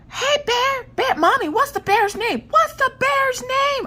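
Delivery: put-on very high, excited child voice; little girl shouting. Little girl asking mommy the bear's name, high-pitched shouting. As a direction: squeal it like an impatient little kid, stretching the end. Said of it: "she's just sitting there shouting".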